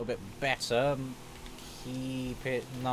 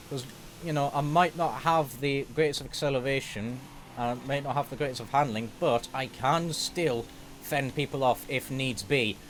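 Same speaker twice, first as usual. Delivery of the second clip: ordinary delivery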